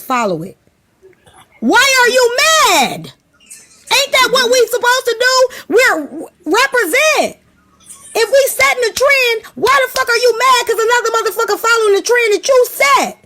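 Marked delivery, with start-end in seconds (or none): 1.6-13.2 heated rant. Real, not acted. Ranting at the audience about trend-setting, high exasperated yell. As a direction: push the pitch up, demanding why.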